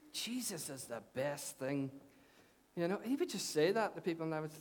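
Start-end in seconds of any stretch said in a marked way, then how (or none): 0.1-1.9 slow, low, badly imitated Scottish accent; Davy Moyer, Scottish accent. Pastor quoting his Scottish friend to the congregation, terrible Scottish accent. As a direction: drag it out softly in a mock Scottish accent. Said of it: "With a Scottish accent, that was terrible".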